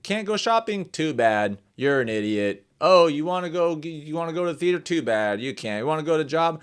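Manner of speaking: high sing-song questions, blunt dismissive answers; mocking taunt. TV host to people without the shot, mocking and dismissive. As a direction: mimic a scornful, gloating scold. Mimics TV hosts scolding the unvaccinated.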